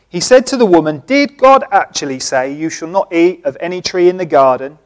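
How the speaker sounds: loud preaching read of scripture. Acted. Preacher reading Genesis loudly to congregation. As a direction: read it out to the whole church.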